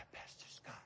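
breathy timid whisper; imitated whisper. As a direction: whisper it shyly like a nervous boy. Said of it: "That's how he spoke".

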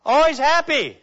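hammed-up happy mask; fake cheer. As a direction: put on a party smile in the voice. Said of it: "mask of being the life of the party".

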